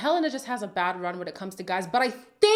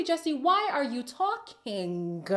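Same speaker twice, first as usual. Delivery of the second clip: slow; 3 semitones higher, much slower than usual. Real, not acted. Addressing Jesse directly.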